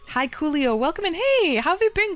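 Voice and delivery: high, bright welcome for a returning friend; excited greeting. Real, not acted. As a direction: greet them like you missed them. Greeting chat viewer excitedly.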